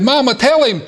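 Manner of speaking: put-on high, urgent child's plea; a boy who can't wait another second; impatient little boy. A narrator's reading. Boy stands up in church, pleading high to his mother. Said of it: "the little boy couldn't stand it any longer".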